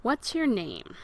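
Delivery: ordinary talk, nothing marked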